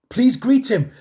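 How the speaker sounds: hamming up the preacher's call; imitating the pastor. Preacher urges the congregation to greet Peter, mock excited.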